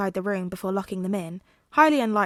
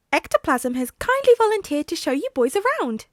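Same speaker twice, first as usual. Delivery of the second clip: higher, louder polite principal; courteous small principal addressing the boys; polite, higher voiced principal; Nezu voice; 8 semitones higher, 6 dB louder than usual. A narrator's reading. Nezu's voice.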